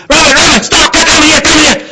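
frantic, high, very loud yelling; panicked scream. Acted. Upset owner as puppy chases cat across road, high scream. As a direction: scream the dog back in an emergency. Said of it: "upset and they scream".

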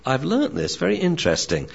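a dog working something out; dog's thoughts. Acted. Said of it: "he says".

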